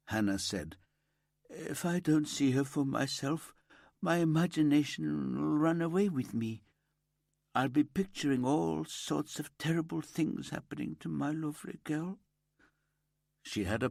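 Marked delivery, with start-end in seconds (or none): 1.5-12.2 lightly voiced mother, raised higher, pleading; a hint of a distraught mother begging to see her child; grieving mother. Mother pleading with detectives to see her dead daughter, higher and pleading.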